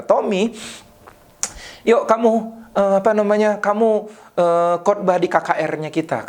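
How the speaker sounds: retold pastor's Ambonese-accented phone call. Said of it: "logat Ambonnya, dia telepon saya".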